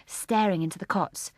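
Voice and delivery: ordinary delivery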